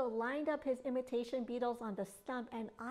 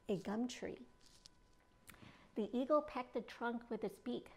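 ordinary delivery

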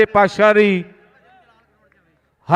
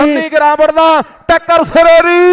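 excited; 4 semitones higher, 4 dB louder than usual. Real, not acted. Praising a tackle live.

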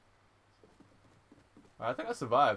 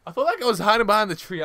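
frantic rant; 9 semitones higher, 11 dB louder, faster than usual. Real, not acted. Frustrated rant after dying.

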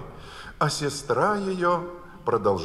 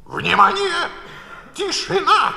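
a high, loud call for order; the King yelling very high for silence; shouted order; 6 dB louder than usual. A narrator's reading. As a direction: shout it out over the courtroom. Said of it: "крикнул".

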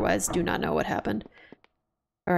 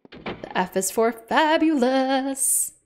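sassy aside; 6 semitones higher, much slower than usual. Acted. Playful aside.

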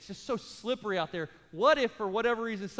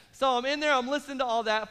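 usual speaking voice

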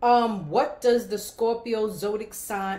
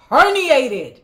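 loud exclamation; 6 semitones higher, 14 dB louder than usual. Real, not acted. Exclaims diagnosis.

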